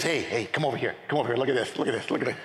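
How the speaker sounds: low, casual conspirator's voice; God beckoning angels. Retold or imitated. God calling the angels over to watch, imitated low aside. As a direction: call them over to see the fun.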